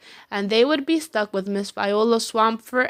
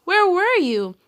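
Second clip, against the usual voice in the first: put-on eager kids; 8 semitones higher, 3 dB louder than usual. A narrator's reading. Kids greet teacher.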